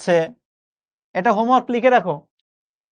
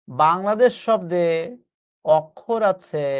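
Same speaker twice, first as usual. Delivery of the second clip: slow teacher answer; 3 semitones lower, much slower than usual. Shown as a demonstration. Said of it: "answer spelled out slowly".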